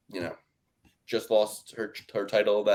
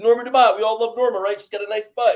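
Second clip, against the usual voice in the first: higher and louder, teasing fan voice; mock gushing fan; 9 semitones higher, 6 dB louder than usual. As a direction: gush mockingly about a favourite fighter. Mocking friend's reasoning.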